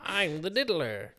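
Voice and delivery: slow high villain voice; villain catchphrase. Acted. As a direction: drawl it like a cartoon villain. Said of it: "he's gotta have a catchphrase".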